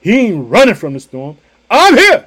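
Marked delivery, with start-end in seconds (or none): 1.7-2.2 high, loud shout of presence; proclaiming Jesus. Retold or imitated. Preacher voicing Jesus's words to the church, a high loud shout. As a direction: shout it like a triumphant promise. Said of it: "That's what he said".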